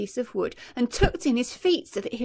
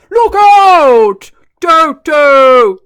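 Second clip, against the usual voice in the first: very loud, higher warning bellow; warning shout; 4 semitones higher, 20 dB louder, much slower than usual. A narrator's reading. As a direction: yell to save the child. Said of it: "shouted Humpy Rumpy".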